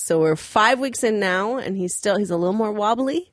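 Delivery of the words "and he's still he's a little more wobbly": normal talking voice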